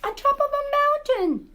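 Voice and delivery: high, amazed storyteller exclamation. Acted. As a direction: gasp it out in wonder at the mountain top.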